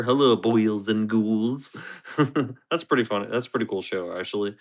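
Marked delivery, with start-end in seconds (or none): 0.0-2.2 slow, drawn-out horror host greeting; Crypt Keeper. Acted. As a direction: greet them as the Crypt Keeper, stretching the words. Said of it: "from the Crypt Keeper".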